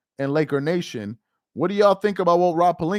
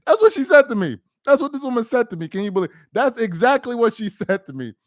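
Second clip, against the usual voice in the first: high and louder, can't believe it; amused disbelief, pitch up; incredulous; 7 semitones higher, 4 dB louder than usual. Real, not acted. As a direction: exclaim it in disbelief. Incredulous retelling of wife's comment.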